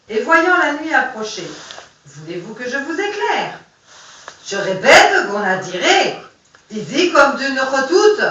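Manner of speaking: dramatic reading of a poem. Acted. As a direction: perform the verses with voices for priest and captain. Reads poem aloud, voices figures.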